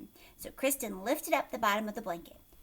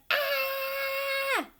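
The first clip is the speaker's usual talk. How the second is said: high shrieking cry of pain; high yelp; 3 dB louder than usual. A narrator's reading. Mother bitten on the nose, high shriek. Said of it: "She yelled".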